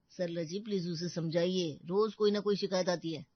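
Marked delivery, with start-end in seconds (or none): none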